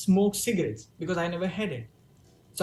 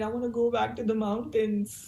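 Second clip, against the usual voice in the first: imitating own tearful plea in a small voice; mock tiny, slightly raised voice; little tiny voice; 3 semitones higher than usual. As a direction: say it small, like a homesick kid. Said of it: "I would somehow say in this little tiny voice".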